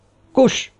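high-pitched silencing command; sharp shout. A narrator's reading. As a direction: cry out to shut someone up. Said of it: "kiáltott rá Fábio". (éles, magas rákiáltás)

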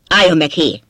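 loud, abrupt call to halt; shouted order. A narrator's reading. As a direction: call out sharply to stop someone. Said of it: "Kiáltott rám Jeff". (rákiáltva, hangosan)

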